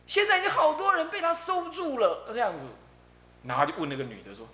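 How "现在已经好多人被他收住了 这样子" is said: high, loud wide-eyed report; a child telling the news breathlessly; girl tells the man what she sees, high and excited; excited little girl. Retold or imitated. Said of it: "小女孩跟他讲说".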